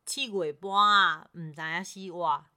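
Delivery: slow, careful Taiwanese Hokkien attempt. Shown as a demonstration. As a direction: learner repeating in Taiwanese Hokkien. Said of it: "好，再一次".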